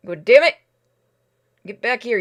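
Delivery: ordinary talk, nothing marked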